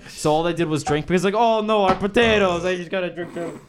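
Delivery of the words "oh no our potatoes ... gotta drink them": a badly faked Irish lament; bad Irish accent. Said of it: "That was so bad".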